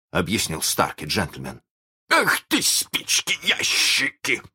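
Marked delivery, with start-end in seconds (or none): 2.1-4.4 high, slow, drawn-out curse; thundering outburst. A narrator's reading. Hook thunders at his pirates, high angry outburst. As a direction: stretch the curse out in high rage. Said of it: "загремел Крюк".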